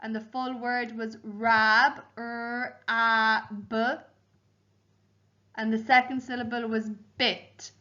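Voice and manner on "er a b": "er, a, b" as separate phonics sounds; sounding out letters. Shown as a demonstration. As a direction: spell by sound: "er, a, b", one at a time. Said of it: "help me spell out the word".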